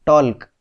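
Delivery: टॉल्क with the L wrongly sounded before K; mispronounced with L. Shown as a demonstration. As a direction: say टॉल्क, voicing the silent L. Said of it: "हम इसको ऐसे नहीं बोलते". (टॉल्क में L बोलकर गलत उच्चारण)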